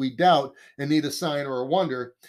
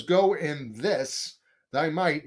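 slow quote; much slower than usual. Shown as a demonstration. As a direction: Go in this drawn out slowly. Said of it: "So when he says".

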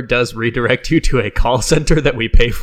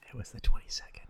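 whispering; 12 dB quieter than usual. Acted. Whispered aside.

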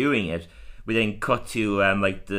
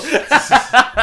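loud high burst of laughter; real laughing outburst; 12 dB louder than usual. Real, not acted. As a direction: crack up loudly.

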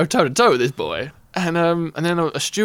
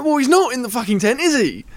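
louder, higher, sarcastic; sarcastic snap; 8 semitones higher, 4 dB louder than usual. Retold or imitated. Man insisting his friend is in the tent, sarcastic retort. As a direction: throw it back at him mockingly. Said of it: "And I go".

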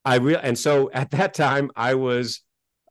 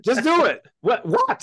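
higher, hammed-up back and forth; worked-up mock dialogue; 9 semitones higher, slower than usual. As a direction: act out the guru and the baffled student. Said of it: "I get a little worked up here".

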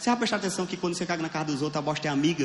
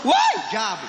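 sudden high disgusted cry; disgusted yelp; 6 semitones higher, 6 dB louder, much slower than usual. Retold or imitated. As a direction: recoil at the sight with a yelp. Disgust at snot on hand. (grito de nojo, agudo e súbito)

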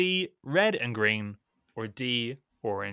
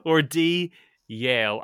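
hammed-up English accent, much louder; 4 semitones higher, 8 dB louder than usual. Scottish host redoes the quiz options in an English accent for Alex. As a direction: read the options in an exaggerated posh English accent. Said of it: "flippin' English accent".

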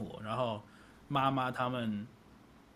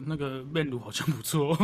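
ordinary talk

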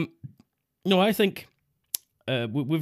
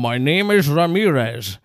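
put-on loud, slow Scottish-accented film hero; Sean Connery; 5 dB louder, slower than usual. Quoting Highlander's supposedly Spanish hero, in a Scottish accent. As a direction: announce it slowly in a heavy Scottish accent. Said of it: "sounds like Sean Connery pretending".

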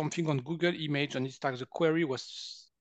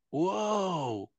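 long, swooping high "whoa"; amazed; 5 semitones higher than usual. Retold or imitated. Healer recalling his return to the lady, drawn-out amazement. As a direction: draw it out in acted awe, rising then falling.

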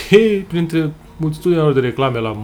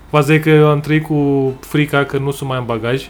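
ordinary delivery

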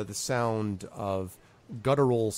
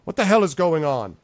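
loud, raised-pitch demand; alarmed demand; 6 semitones higher, 7 dB louder than usual. Acted. Zolkin at the inn door hears screams, alarmed demand. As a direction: demand answers from the doorway.

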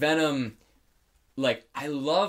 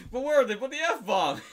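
laughing; 10 semitones higher than usual. Retold or imitated. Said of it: "that meme, it's like".

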